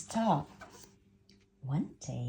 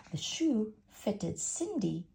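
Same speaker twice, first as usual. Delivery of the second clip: ordinary delivery, nothing marked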